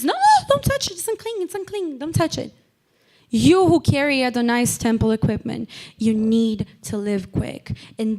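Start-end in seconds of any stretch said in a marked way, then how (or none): 0.0-2.5 high-pitched, frantic acted warning; mock alarmed warning. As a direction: jump up high in hammed-up alarm, then drop the volume. Acts out frantic warning to objector.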